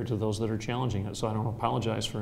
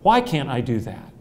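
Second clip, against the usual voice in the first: defiant why; 3 semitones higher, 6 dB louder, slower than usual. Retold or imitated. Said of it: "And I'm gonna say".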